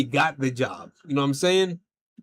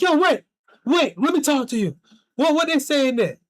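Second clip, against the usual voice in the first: high, loud, hurried calling after someone; urgent call; 10 semitones higher, 4 dB louder than usual. Retold or imitated. Coworker chasing him out of the shop, high urgent calling. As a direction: stop him before he leaves, eager.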